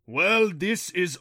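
loud, slow, high villainous sigh; mock sigh. A narrator's reading. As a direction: drag it out with loud mock weariness. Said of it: "the Rompo sighed".

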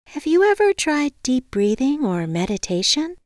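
high, lilting suggestion; sweet receptionist. A narrator's reading. Receptionist suggests meditation to the caller, sweetly.

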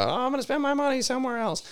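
high, far mocking imitation of a customer; the owner mimics a complaining customer. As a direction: parody her as a whiny customer. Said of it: "she just won't shut up about how".

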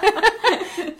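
high burst of laughter; loud laugh. Real, not acted. Big laugh at friend's claim.

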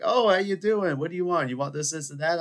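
high, loud welcome in imitation; mock eager greeting. Restaurant staff greet a returning regular, mock eager greeting.

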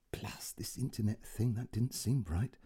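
put-on puzzled old man whispering. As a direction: murmur it to yourself, unsure. Puzzled inner aside.

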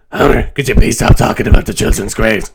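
lightly voiced Marge Simpson, complaining; Marge impression. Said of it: "that's my Marge impression".

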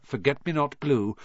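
plain reading voice

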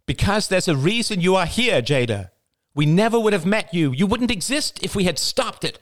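high, forceful insistence. A narrator's reading. Marie insisting to Jada, forceful high voice. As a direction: press the point firmly. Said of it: "Marie's voice grew forceful".

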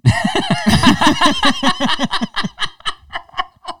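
shrieking, helpless laughing fit; loud burst of laughter. Real, not acted. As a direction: explode into high laughter. Bursts out at dead dad joke.

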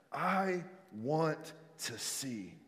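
hushed and deliberate, word by word; slow, soft answer. Acted. Blind man answering Jesus, slow and soft. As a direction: say it softly, stretching each word.

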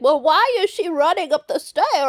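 a hint of a grumpy old man; lightly voiced old man, complaining. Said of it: "Is it old man vibes?".

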